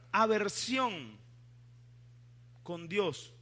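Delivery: usual speaking voice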